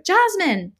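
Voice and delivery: high, frightened call of a name; wild with fear. A narrator's reading. Father calling to his trapped daughter, wild with fear. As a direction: call out in alarm for your child. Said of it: "his voice wild with fear".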